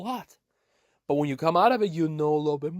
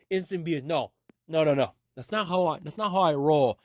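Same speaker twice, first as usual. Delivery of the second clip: normal talking voice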